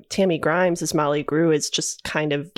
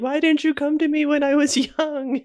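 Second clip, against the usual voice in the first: Molly Grew yelling; 9 semitones higher than usual. Retold or imitated. Fan quoting the yelling scene at the unicorn, high mock yell. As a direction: imitate an anguished reproach, pitched well up. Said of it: "when she was yelling at the unicorn".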